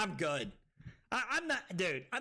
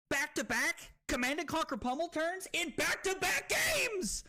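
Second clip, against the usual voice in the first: high hype building to a yell; excited announcement rising to a shout; triumphant boast; 8 semitones higher than usual. Real, not acted. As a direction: crow about an unbelievable repeat win. Boasting of back-to-back wins.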